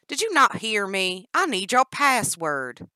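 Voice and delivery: loud, slow impatient demand; angry demand. Acted. Danny to Jennifer, impatient loud demand. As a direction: lose patience and push it. Captor repeats demand angrily.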